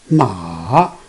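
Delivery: long "Ma" sinking very low, then rising; third tone. Shown as a demonstration. Teacher showing the third tone, "Ma" down then up. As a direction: drop "Ma" deep and swing it up. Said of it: "coming down and then go up".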